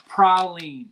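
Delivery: "praline" said PRAH-leen; local pronunciation. Shown as a demonstration. Giving viewers the proper local word, "praline" as PRAH-leen. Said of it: "proper pronunciation is".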